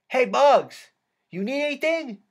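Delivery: high-pitched holler, rising to the offer; yelled. A narrator's reading. Spanky offering help from the other side, yelled. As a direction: call over to a friend across the room. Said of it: "He yelled".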